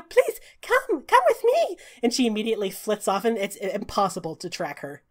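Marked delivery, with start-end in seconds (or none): 0.0-1.8 high, bright fairy voice; squeaky little fairy greeting; tiny pixie voice. Acted.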